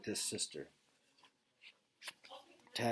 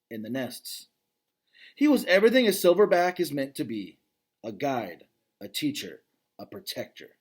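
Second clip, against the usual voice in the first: clear, fuller read-aloud voice, brighter than his asides; story narration; 5 semitones higher, 8 dB louder than usual. Acted. Dad reading a gorilla novel to his kids, storytelling narration.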